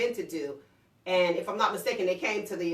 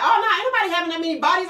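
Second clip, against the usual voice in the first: fast, higher, louder daughter's brush-off; imitating daughter; 8 semitones higher, 8 dB louder, much faster than usual. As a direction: rattle it off like a teen. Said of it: "she was like".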